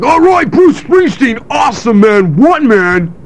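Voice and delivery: high, loud, whooping concert fan; gushing drunk fans. Retold or imitated. Drunk fans cheering [name] from their seats, gushing. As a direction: holler it like a boozed-up superfan. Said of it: "they were like".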